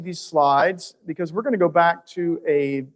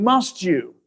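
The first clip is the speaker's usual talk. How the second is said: "must you" asked, with weight on "must"; said as question. Shown as a demonstration. Preacher showing listeners the question form, questioning "must you". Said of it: "if we say it as a question".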